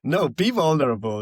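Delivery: loud, high encouragement; playful urging. Real, not acted. Friend urging the host to let tears out, playful and loud. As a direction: call it out, jokingly insistent.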